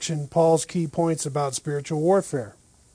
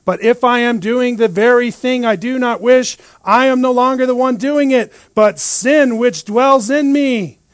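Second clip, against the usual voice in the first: louder, rising, emphatic reading; preaching the text; 5 semitones higher, 7 dB louder than usual. Acted. Pastor reading Romans aloud to the church, preaching. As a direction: proclaim Paul's lament with force. Reads Paul with force.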